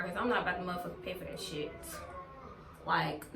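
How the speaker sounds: ordinary talk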